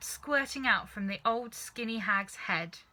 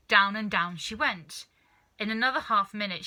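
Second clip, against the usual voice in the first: usual speaking voice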